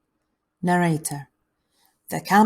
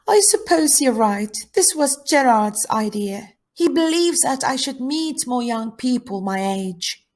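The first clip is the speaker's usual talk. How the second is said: lightly voiced girl, a little higher; reserved camper girl; 6 semitones higher than usual. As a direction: play a reserved girl conceding a point.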